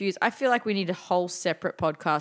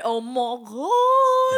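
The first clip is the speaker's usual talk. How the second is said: excited; 7 semitones higher, 6 dB louder, much slower than usual. Real, not acted. Excited realization.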